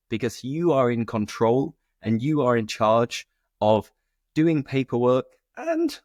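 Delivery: ordinary delivery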